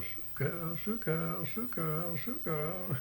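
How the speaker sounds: high, repeated sing-out cattle call; holler. Shown as a demonstration. Demonstrating how others call cows, high-pitched holler. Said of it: "they just holler".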